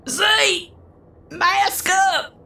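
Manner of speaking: high, urgent battle call; in-character high holler to an ally; calling out a warning. Acted. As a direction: call out a high-pitched order to a teammate. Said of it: "I'm gonna be like".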